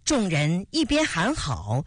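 plain reading voice

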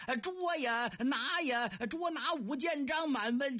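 high stretched shouting cry; repeated bellow. Acted. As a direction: holler it out yet again. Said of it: "好，再喊一遍".

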